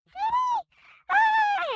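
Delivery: very high, loud excited squeal; thrilled squeal. Acted. Girl to the nightmare, very high loud excited squeal. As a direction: squeal with delight.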